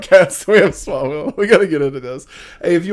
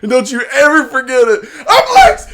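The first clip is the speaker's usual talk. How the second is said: high, loud falsetto villain boast; hammed-up falsetto climbing into laughter; 8 semitones higher, 5 dB louder than usual. Host playing Lex boasting to Clark, turning into loud falsetto. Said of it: "He just turns into [name]".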